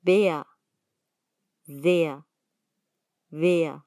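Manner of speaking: Vea, Sehr, Wer with the final R opened into an "a". Shown as a demonstration. Teacher showing learners that R sometimes sounds like A, final R as "a" in Vea, Sehr, Wer. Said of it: "a veces suena como A".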